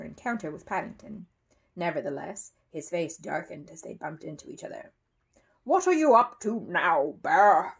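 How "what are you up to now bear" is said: loud, slow growl at the bear. A narrator's reading. Mr Curry confronts Paddington as they bump together, growling. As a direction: growl it out as a challenge. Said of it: "he growled".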